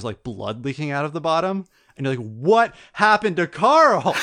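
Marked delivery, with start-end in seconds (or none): 2.3-4.2 loud, high, slow wail with a laugh behind it; mock outcry. Player reacts to the man's disappearance, hammed-up horror. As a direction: stretch it out in hammed-up dismay.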